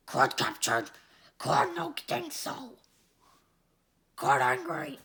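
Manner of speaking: a dim-witted brute asking; deep brute voice. Acted. Brute Crud voiced by reader.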